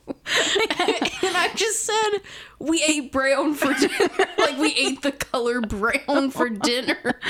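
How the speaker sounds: giggling while talking; breaking up with laughter; laughing. Real, not acted.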